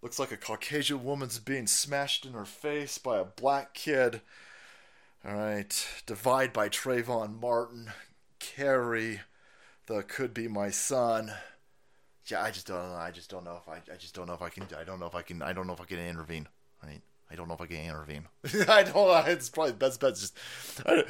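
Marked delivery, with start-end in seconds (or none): 0.0-11.3 muttering recruiter. Retold or imitated. 12.3-18.3 low, much softer fretful mumble; dithering recruiter. Retold or imitated. Recruiter unsure whether to step in, low and soft.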